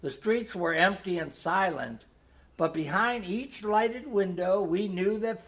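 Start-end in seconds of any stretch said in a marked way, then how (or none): none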